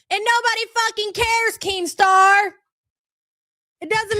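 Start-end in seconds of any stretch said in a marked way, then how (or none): 0.1-2.5 higher, louder call-out ending on the name; angry call-out. Real, not acted. Ranting streamer calls out Keemstar by name, angry and loud. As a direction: yell the name like a challenge.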